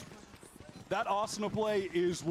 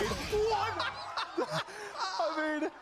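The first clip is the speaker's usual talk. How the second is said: laughing; 11 semitones higher, much slower than usual. Real, not acted. Caster laughs in disbelief.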